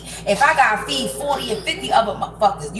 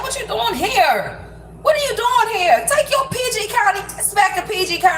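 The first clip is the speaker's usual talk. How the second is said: mock homegirl taunt; 9 semitones higher than usual. Homegirls ribbing him about being in Baltimore, high mock taunt.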